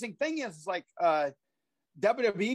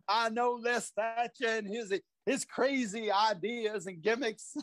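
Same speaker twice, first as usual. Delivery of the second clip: slow veteran wrestler impression; put-on, drawn-out veteran voice; Tony Atlas; slower than usual. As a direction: draw it out, imitating an old wrestler. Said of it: "not a bad impression of him".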